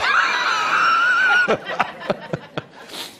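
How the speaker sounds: high, loud delighted whooping laugh; joyous laughing whoop. Real, not acted. Joyful laugh after the story.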